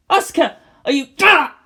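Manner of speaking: loud high alarmed cry. Acted. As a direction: call out then gasp. Shinji cries out.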